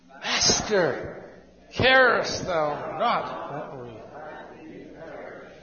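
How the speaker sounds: a slow, hushed, frightened cry of Master, carest thou not that we perish, fading to a whisper on perish; terrified plea. Shown as a demonstration. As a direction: plead slowly, as if terrified on a sinking boat. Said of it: "They were terrified".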